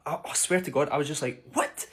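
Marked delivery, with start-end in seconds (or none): 1.5-1.9 very high-pitched incredulous yelp; retold shock, pitched right up; shocked disbelief. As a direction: react in stunned, squeaky disbelief. His shocked reaction to the insult.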